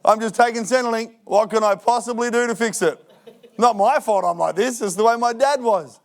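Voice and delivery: hammed-up whiny excuses; mock excuse-maker voice. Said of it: "And that's what he says".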